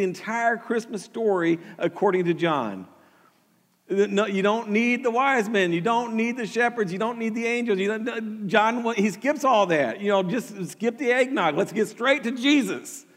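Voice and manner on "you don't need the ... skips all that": ordinary talk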